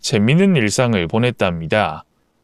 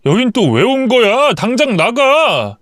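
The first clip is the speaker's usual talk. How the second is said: angry shout; 6 semitones higher than usual. A narrator's reading. Husband finds his father in the house, angry shout. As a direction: throw him out. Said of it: "소리를 꽥 질렀답니다".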